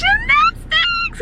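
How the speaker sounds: squealing with excitement for a little one; excited cheer. Real, not acted. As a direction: cheer it high and stretch it out. Cheering along with small child.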